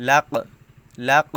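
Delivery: লাক with the final k bouncing out sharply; লাক ending in a short echoing k jolt; qalqalah bounce. Shown as a demonstration. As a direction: knock the k of লাক so it rebounds. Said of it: "কলকলাহর হরফ আসলে একটু ধাক্কা দিয়ে পড়তে হয়".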